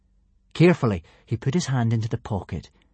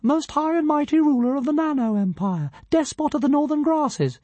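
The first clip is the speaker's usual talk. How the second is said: pompous tiny dragon. A narrator's reading. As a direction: announce yourself like a pint-sized deity.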